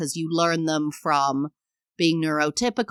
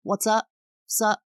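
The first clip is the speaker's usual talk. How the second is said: American casual greeting; 4 semitones higher than usual. Retold or imitated. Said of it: "when Americans say".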